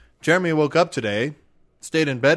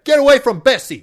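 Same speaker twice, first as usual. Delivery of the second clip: furious high shout; angry farmer; 10 semitones higher, 10 dB louder than usual. Acted. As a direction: yell at the intruder.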